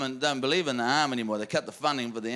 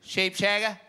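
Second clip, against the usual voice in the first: Aussie missus; 6 semitones higher than usual. Acted. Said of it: "She went".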